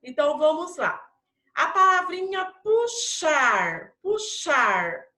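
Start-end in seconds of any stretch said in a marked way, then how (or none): 2.6-5.1 "puxar" with a clear sh sound in the middle. Shown as a demonstration. Teacher quizzing pupils on the X sound, "puxar" with a clear sh. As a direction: sound out "puxar" in syllables, stretching the sh. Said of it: "tem som do quê? De C H".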